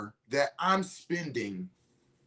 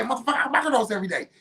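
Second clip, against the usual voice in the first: fast, higher-pitched acted comeback; heated retort; 6 semitones higher, much faster than usual. Debater insisting on his own daily microdosing, heated retort. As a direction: fire back in a quick retold argument.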